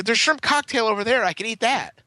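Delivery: high-pitched, pointed objection; retold protest. Picky party guest pointing the waitress to other food, protesting.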